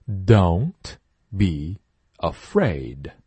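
very slow don't be afraid, weight on don't; slow model sentence. Shown as a demonstration. Teacher modelling a sentence slowly. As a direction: draw each word out for students. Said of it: "都是的比较重的那个".

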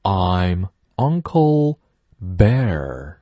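slow, drawn-out catchphrase; slow teacher catchphrase; slow model reading. Shown as a demonstration. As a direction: read it slowly, stretching Uncle. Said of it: "I say this all the time".

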